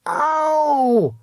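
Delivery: high sharp cry of pain; yelp of pain. A narrator's reading. Roddy's arm twisted behind him, pained yelp. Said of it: "Roddy yelled in pain".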